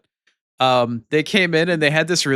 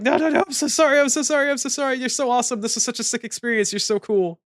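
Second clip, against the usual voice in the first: much higher rapid apology, settling down; gushing apology; 12 semitones higher than usual. Retold or imitated. Streamer apologizing to timed-out viewers, gushing. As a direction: pour out sorry after sorry in a rush. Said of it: "And I'm like".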